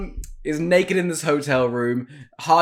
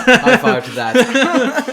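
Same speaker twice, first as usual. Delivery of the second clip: excited cheer; 8 semitones higher, 7 dB louder, much slower than usual. Real, not acted. Cheery shout.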